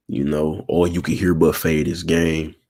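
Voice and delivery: usual speaking voice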